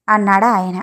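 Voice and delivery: usual speaking voice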